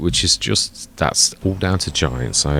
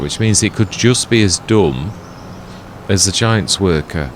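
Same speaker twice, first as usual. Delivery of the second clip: ordinary delivery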